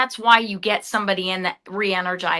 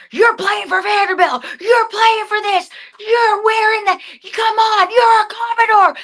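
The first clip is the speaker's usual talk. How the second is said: high, loud rah-rah coach voice, pumping up the team; fired-up coach impression; 9 semitones higher, 3 dB louder than usual. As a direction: shout it like a coach hyping his players. Imitating Clark Lea firing up players.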